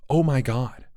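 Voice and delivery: ordinary delivery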